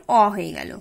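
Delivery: normal talking voice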